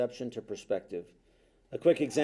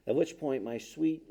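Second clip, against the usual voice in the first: usual speaking voice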